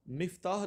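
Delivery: مفتاح with short i, miftah; correct pronunciation. Shown as a demonstration. Teacher gives the right word, clear pronunciation. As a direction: open مفتاح with mif-. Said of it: "اس کو ہم مفتاح کہتے ہیں".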